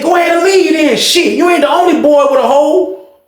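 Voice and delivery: high-pitched scornful send-off; disrespectful. Retold or imitated. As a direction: throw the insult in his face. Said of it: "some major disrespectful stuff".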